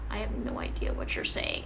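soft, under-the-breath confused aside; quiet mock bewilderment; hushed inner voice. Acting out own confused thought in class.

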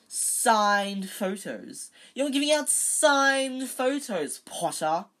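slow, high, loud mockery; loud and scathing. A narrator's reading. Malfoy taunting Potter across the courtyard, loud and scathing. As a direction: jeer it out for all to hear. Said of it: "Loud and scathing".

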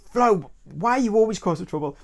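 grandma's loud, high-pitched telling-off; scolding shout. Retold or imitated. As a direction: shout her down for stirring trouble. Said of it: "she's shouting at Flo".

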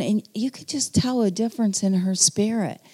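ordinary delivery

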